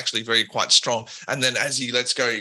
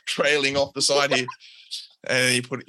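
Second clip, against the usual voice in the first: ordinary delivery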